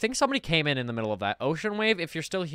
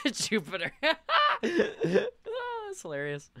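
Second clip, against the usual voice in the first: real laughter; 11 semitones higher, 3 dB quieter than usual. Real, not acted. Laughing at joke.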